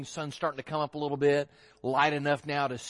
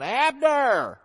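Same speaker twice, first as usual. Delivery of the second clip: high, loud yell from a hilltop; big calling-out shout; 10 semitones higher, 13 dB louder, much slower than usual. Acted. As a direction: holler to wake someone far below. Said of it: "he's yelling down".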